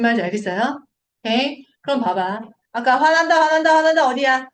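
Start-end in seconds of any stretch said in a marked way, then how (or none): 3.0-4.1 loud, high, rapid-fire outburst; playfully agitated, raised-voice repetition; mock anger. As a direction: play the amygdala flaring up. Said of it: "편도체야".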